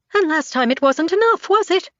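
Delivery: shrill distorted woman; high and fast. A narrator's reading. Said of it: "Hers is high and fast".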